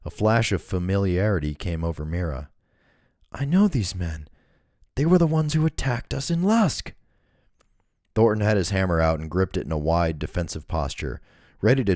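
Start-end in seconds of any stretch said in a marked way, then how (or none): 3.3-6.9 put-on high, alarmed young woman. A narrator's reading. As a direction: realize it with sudden alarm. Mira voiced in a story.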